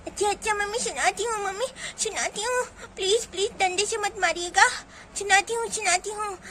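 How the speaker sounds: put-on high, tearful lisping child; frightened little girl. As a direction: beg anxiously in a child's voice. Child begs not to be hit. (डरी, गिड़गिड़ाती तुतलाती बच्ची)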